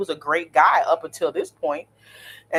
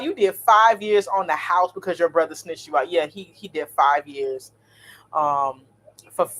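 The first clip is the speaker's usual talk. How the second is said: ordinary talk, nothing marked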